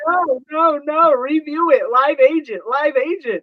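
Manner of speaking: high, loud exasperated pleading; frustrated shouting at phone. Retold or imitated. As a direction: demand a live agent, fed up. Angry demand for live agent.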